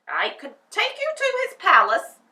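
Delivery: high, loud gatekeeper voice. A narrator's reading. Gatekeeper tells Dorothy about the palace, loud and high. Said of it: "said the man".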